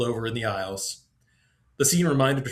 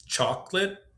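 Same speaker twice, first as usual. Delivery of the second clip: put-on child trying a word; 7 semitones higher, slower than usual. A narrator's reading. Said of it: "trying the word out".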